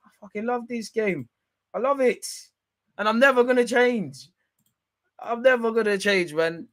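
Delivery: high, excited declaration; gleeful. Real, not acted. Telling viewers he loves the tears, gleeful and high. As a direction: revel in it.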